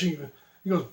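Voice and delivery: normal talking voice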